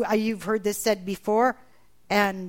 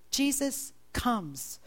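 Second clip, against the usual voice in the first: normal talking voice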